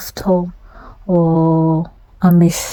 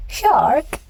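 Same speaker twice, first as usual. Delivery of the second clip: surprised; 7 semitones higher, 3 dB quieter than usual. Acted. Startled question in story.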